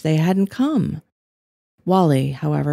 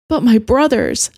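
anguished; 7 semitones higher, 5 dB louder than usual. A narrator's reading. Landry's anguished protest.

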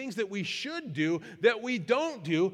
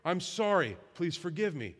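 earnest, subdued plea for forgiveness; sincere apology; 3 semitones lower than usual. Shown as a demonstration. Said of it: "To say that with sincerity".

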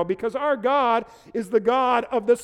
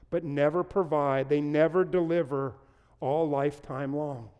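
softer, lower, sober reflection; quiet earnest preaching; 6 semitones lower, 6 dB quieter than usual. Acted. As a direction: lean in and speak it gently. Quiet reflective sermon passage.

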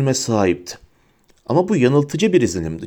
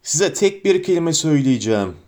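ordinary delivery

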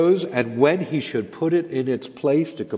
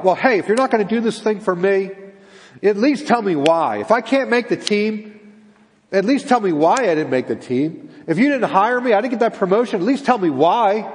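raised, insistent complaining; indignant demand; 5 semitones higher, 3 dB louder than usual. Acted. Disappointed person demanding to be told why, indignant. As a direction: press for an answer, pitch up. Acting out people demanding an explanation.

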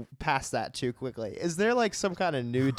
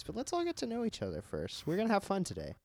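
mock cheery switch; 7 semitones higher, 6 dB quieter than usual. S1 acting out teacher Sam.

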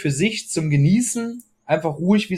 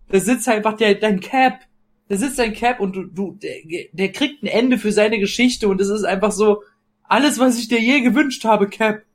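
gushing; 6 semitones higher than usual. Real, not acted. Gushing over Cap's ending.

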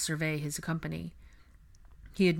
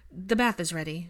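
usual speaking voice

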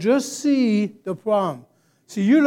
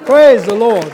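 preaching at full force; 8 semitones higher, 13 dB louder than usual. Acted. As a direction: proclaim it over the church. Call and response climax.

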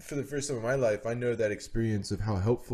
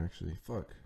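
trailing off; 5 semitones lower, 11 dB quieter than usual. Real, not acted. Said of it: "I forgot what I was saying".

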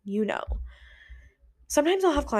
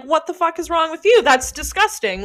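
loud, disgusted mock outrage; mock outraged yell; 7 semitones higher, 10 dB louder than usual. Retorting to friends who eat cream eggs, loud disgusted outrage. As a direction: throw it back at them, louder and appalled. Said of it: "and I'm like".

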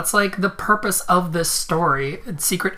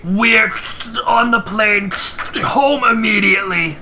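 louder words through phone static; clearer phone line; 3 semitones higher, 5 dB louder than usual. Acted. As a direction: shout through a crackling line.

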